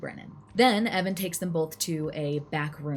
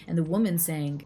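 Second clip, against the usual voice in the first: ordinary talk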